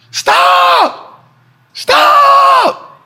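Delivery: high falsetto scream; screaming stop. Retold or imitated. The market screaming at Jerome to stop, high shriek. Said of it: "The market is screaming right now".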